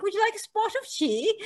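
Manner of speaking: put-on posh English accent, pitched high. Teasing goddaughter on English day, put-on English accent. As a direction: play the proper English host offering tea in an English accent. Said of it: "talk with an English accent".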